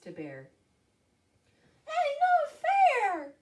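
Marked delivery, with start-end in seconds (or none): 1.9-3.3 very high, loud mouse squeal; slow, piercingly high mouse cry; squeaked protest. Acted. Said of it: "squeaked Mouse".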